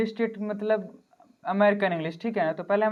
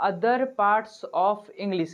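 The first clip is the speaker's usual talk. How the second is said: slow dictation; much slower than usual. Shown as a demonstration. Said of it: "अब चलिए हम लोग कुछ समझेंगे".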